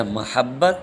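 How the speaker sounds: محبت as "ma-HAB-bat", short a first, doubled b; correct pronunciation. Shown as a demonstration. Teacher giving students the right reading, careful "mahabbat" for محبت. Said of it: "درست لفظ ہے".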